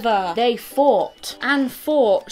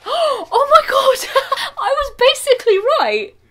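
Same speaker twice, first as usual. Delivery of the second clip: much higher and louder, laughing in disbelief; delighted shriek; 13 semitones higher, 7 dB louder than usual. Real, not acted. Reader finds her guess came true, squealing with laughter. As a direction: squeal it out, thrilled you were right.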